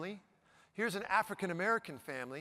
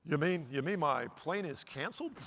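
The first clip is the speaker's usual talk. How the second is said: mock weepy; 4 semitones lower than usual. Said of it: "this is what you're gonna see".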